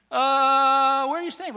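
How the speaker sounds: drawn-out loud "uh", then a high, awkward question; stall, then blurt it out high and awkwardly; squirrely. Acted. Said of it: "too squirrely to ask a real question".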